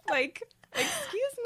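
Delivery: high, drawn-out "excuse me"; slow, high-pitched mock outrage; mock indignant. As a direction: play offended, stretching it out high. Acting offended at an unliked story.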